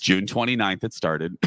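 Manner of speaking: ordinary delivery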